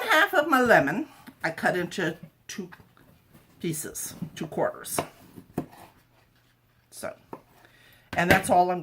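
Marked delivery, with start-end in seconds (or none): none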